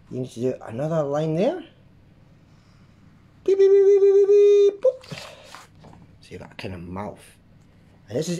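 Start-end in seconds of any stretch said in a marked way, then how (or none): 3.4-5.2 beeping sounds. Pretend. Sound effects while drawing.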